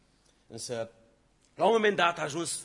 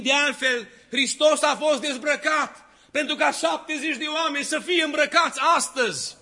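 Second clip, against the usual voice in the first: high, loud fervent preacher's voice; full-force preaching; 10 semitones higher, 12 dB louder than usual. Acted. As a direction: preach it with burning fervor to the whole hall. Preacher exhorts congregation at full force.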